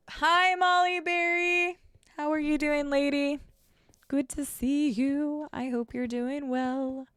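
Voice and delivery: high, slow sweet hello; sing-song greeting. Real, not acted. Streamer greeting Molly Berry as she arrives, sing-song hello. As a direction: draw it out high and friendly.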